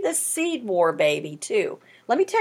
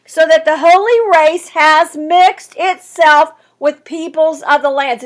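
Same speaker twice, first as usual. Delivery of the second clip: preacher pressing the verse home; stern reading; 3 semitones higher, 9 dB louder than usual. Acted. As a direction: lean hard on the holy race. Emphatic scripture reading.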